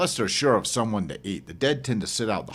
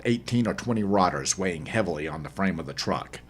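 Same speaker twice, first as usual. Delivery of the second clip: plain reading voice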